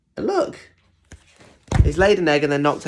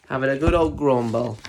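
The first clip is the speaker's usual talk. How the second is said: ordinary delivery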